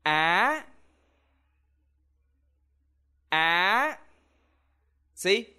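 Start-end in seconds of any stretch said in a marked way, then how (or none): 0.1-4.0 á rising high and sharp at the end. Shown as a demonstration. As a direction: lift á upward like a question. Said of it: "raise your voice when you speak".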